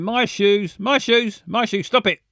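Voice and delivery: high, loud mock calling across a park; calling a dog. Owner calling the dog across the park, high loud yelling. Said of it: "Running across the park screaming".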